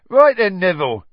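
high, loud cockney-ish conductor greeting; Stan's cheery conductor voice. Acted. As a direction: boom it out like a chirpy bus conductor. Bus conductor Stan greets Harry.

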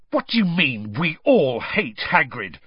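a stung boy spitting back at his mocker; spat out. A narrator's reading. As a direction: fling the words at him with contempt. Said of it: "Harry spat".

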